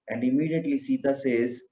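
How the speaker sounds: normal talking voice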